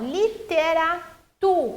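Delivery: "literatura" with TU set apart and stressed. Shown as a demonstration. Teacher showing the stressed syllable, "literatura" with emphatic TU. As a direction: break "literatura" before a strong TU. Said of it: "o tu é a sílaba mais forte".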